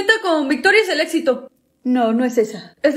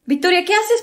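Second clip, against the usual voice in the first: usual speaking voice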